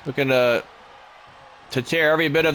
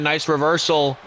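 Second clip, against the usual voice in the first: ordinary delivery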